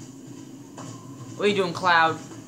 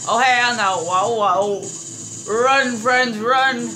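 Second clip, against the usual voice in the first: high, loud panicked yells; urgent shout, much higher and louder; alarmed shouting; 6 semitones higher, 8 dB louder, much slower than usual. Real, not acted. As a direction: yell for everyone to flee. Fleeing a threat in game.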